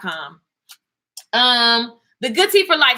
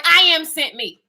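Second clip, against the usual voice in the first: normal talking voice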